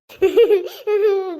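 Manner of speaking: hearty burst of laughter, loud. Acted. Neighbour bursts out laughing at grandma's work, loud. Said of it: "ಅದೇನ ಕಮ್ಮಿ ಹಂಗ್ ನಗ್ತಾ ಇದ್ದೆ".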